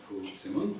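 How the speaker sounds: normal talking voice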